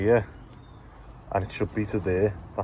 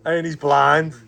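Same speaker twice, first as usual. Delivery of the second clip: ordinary talk, nothing marked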